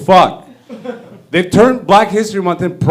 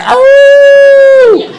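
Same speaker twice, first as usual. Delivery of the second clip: howling, then shouting loud; hammed-up werewolf howl into a shout; 10 semitones higher, 8 dB louder, slower than usual. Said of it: "like, werewolf at midnight".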